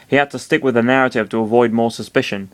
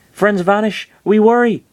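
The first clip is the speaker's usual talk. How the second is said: high, bouncy little creature squeak; high-pitched cute creature voice; cartoon creature; 10 semitones higher, 4 dB louder than usual. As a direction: pipe it up like an odd little critter. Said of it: "the Mister Saturn said".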